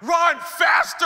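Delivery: loud, drawn-out high shout; coach's yell. Acted. Coach to exhausted player at practice, yelling. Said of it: "Coach's like".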